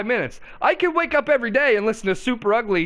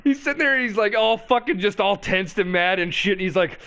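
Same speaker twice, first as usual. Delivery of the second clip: ordinary talk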